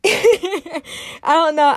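high, loud laughing words. Real, not acted. Host laughing off the urge to cry before listeners, laughing. Nervous laugh while fighting tears.

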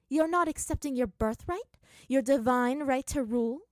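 loud, high, imperious questioning; incredulous. Acted. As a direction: press her with royal disbelief. Princess incredulous at refused birthright.

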